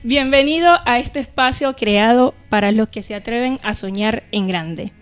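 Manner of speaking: ordinary delivery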